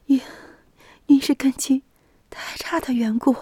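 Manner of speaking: faint, high whispered last words; dying whisper. Acted. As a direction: whisper it as life fades.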